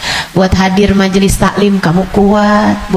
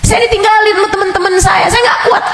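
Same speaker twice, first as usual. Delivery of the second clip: much higher, shouted plea; desperate congregant; 12 semitones higher, faster than usual. Retold or imitated. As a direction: cry out at your limit. Congregant complaining. (jamaah mengeluh ke ustazah, berteriak putus asa)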